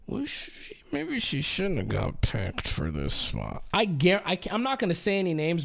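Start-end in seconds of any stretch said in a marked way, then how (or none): 0.1-3.6 lower, snide insider aside; smug Twitch staffer. Retold or imitated. Imagined Twitch staffer doubting the streamer's spot, lower snide mock voice. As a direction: voice a smug staffer second-guessing the pick. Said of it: "who are sitting there like".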